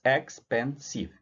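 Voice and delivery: "expensive" said slowly, syllable by syllable; slow model word. Shown as a demonstration. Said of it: "São três sílabas".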